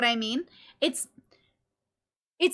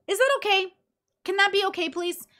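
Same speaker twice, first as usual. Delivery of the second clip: high-pitched playful begging; mock pleading; 8 semitones higher than usual. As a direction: plead for permission in a raised pitch. Mock plea for permission to scream.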